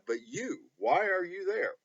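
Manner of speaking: ordinary talk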